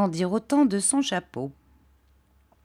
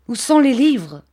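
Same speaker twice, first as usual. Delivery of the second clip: higher, louder enraged demand; cry of rage; 6 semitones higher, 8 dB louder than usual. A narrator's reading. Uriah finding the safe empty, shouting in rage. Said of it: "s'écria-t-il avec une effroyable expression de rage".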